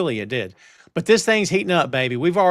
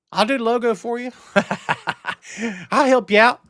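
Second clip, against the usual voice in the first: laughing offer; 5 semitones higher than usual. Real, not acted.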